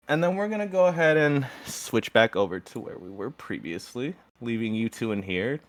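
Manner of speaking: ordinary delivery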